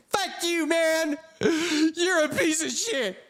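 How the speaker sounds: high, whiny mock voice, slow at the end; mocking angry viewer. Streamer mocking a hater who curses at him. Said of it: "and say".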